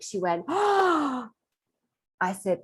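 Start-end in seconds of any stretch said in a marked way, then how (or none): 0.5-1.3 high, drawn-out gasp of horror; imitated shocked gasp. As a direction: gasp as the shocked client did. Client's shocked reaction, imitated.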